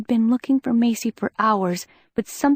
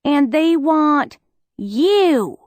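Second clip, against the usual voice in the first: slow, hard, weighted words; firm and cold; steely; 5 semitones higher, 4 dB louder, much slower than usual. Acted. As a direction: make every word steel. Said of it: "her words turned to steel".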